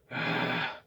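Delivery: breathy sigh pushed harder. Shown as a demonstration. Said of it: "start pushing a little more".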